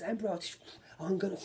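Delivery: Emperor called out high again, a little gloating; high announcing cry. Retold or imitated. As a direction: show off the summon like a cocky gunman. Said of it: "he gets it like this".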